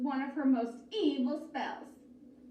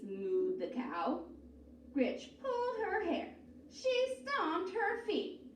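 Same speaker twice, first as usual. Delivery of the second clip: ordinary delivery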